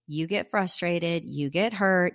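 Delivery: ordinary delivery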